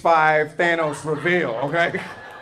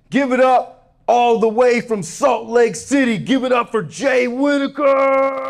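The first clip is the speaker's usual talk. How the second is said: higher, slow booming emcee call; announcer intro; 8 semitones higher, 4 dB louder than usual. Retold or imitated. Emcee announcing the comic to the crowd, booming. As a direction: hype the crowd like a club host. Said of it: "host introducing the comic".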